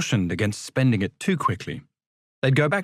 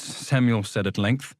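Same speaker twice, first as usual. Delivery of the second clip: ordinary delivery, nothing marked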